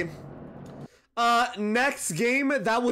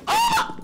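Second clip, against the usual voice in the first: startled scream; 4 dB louder than usual. Real, not acted. Scared in horror game.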